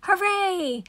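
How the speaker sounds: high excited cheer. Acted. Everyone cheers at the new playground, high cheer. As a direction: celebrate the finished playground.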